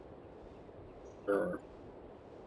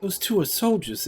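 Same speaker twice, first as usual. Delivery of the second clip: onlooking game character; 7 semitones higher than usual. Acted. Another character remarks on the pair.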